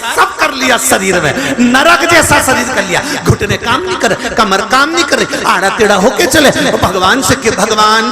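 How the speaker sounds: scolding guru, impassioned. Acted. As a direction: berate the rich man in a relentless rush. Guru's tirade at the seth.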